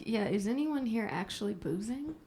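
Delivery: usual speaking voice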